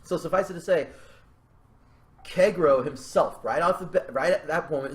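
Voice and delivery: usual speaking voice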